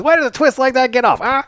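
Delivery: high-pitched forties bad-guy gangster voice; hammed-up tough guy from a forties picture. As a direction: do an old-movie gangster, pushed way up high. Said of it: "forties bad guy gangster".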